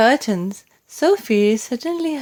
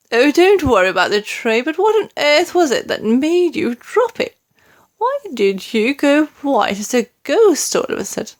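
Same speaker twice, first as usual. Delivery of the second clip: queen; 6 semitones higher, 4 dB louder than usual. A narrator's reading. Queen pressing the maid.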